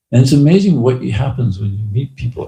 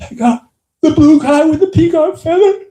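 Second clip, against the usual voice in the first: high, loud, awed exclaiming; excited stranger's high cry of wonder; amazed old man; 6 dB louder than usual. Retold or imitated. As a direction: burst out like you can't believe it. Older man's amazed claim quoted.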